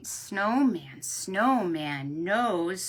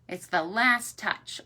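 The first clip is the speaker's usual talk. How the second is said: ordinary delivery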